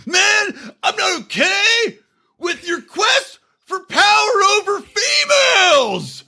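slow, high-pitched hammed-up shout; drawn-out, high mock-heroic yell. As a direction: bellow it as a ridiculous righteous declaration. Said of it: "he starts yelling at Luke".

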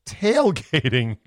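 Tailgating said slow and drawn out; Tailgating stretched with a wink; slow sly reveal. Pretend. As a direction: land Tailgating as a knowing punchline. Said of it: "what's the word?".

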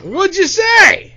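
high, loud and drawn out; insistent repeat question. Real, not acted. Asks again, louder and slower.

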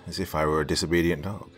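ordinary delivery

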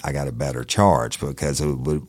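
plain reading voice

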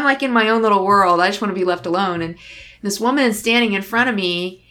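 usual speaking voice

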